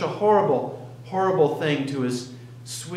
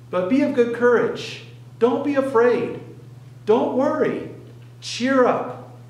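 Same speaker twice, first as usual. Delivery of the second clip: fervent exhortation; 8 semitones higher than usual. Acted. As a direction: lift the crowd with it. Preacher voicing Jesus' encouragement.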